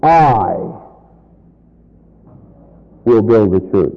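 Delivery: emphasize "I", pause, drop for the rest; stress on I. Shown as a demonstration. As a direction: hit the word "I". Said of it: "Emphasis, I".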